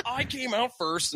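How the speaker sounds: high, imitated complaint; mock indignant protest. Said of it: "Like, he's like".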